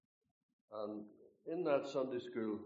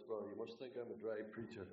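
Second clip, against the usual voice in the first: usual speaking voice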